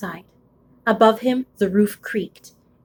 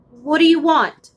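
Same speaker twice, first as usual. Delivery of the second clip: put-on child's voice; 7 semitones higher, 5 dB louder than usual. Laszlo asks the dark.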